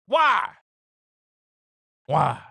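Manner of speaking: a loud indignant Why; mock shout. Mocking the reporter's question, a loud mock Why. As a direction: call out Why with mock outrage.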